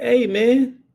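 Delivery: a high mock voice of a casual deputy brushing him off; dismissive deputy. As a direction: imitate the deputy shrugging it off. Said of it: "the sheriff deputy looked at him and said".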